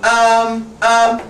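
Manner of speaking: drawn-out mock stalling ums; imitated hesitation. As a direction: imitate a student stuck for an answer. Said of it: "If they start going".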